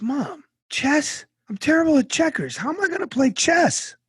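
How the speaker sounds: mock kid protesting to mom; whiny kid. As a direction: squeal it like a despairing boy. Crying boy complains to mom.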